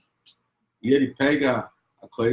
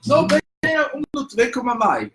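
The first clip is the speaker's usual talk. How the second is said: higher, louder, rushed mom pleading; urgent mother; 12 semitones higher, 5 dB louder, faster than usual. Retold or imitated. Mother hastily urging her son into the doctor's room, rushed. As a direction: hurry him along before he bolts.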